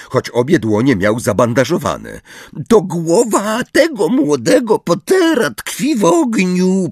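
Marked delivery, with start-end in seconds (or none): 2.7-6.9 high, slow creature voice; house-elf voice. A narrator's reading. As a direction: announce it to nobody in a high elf voice. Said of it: "poinformował pustą kuchnię". (wysoki, powolny głos skrzata)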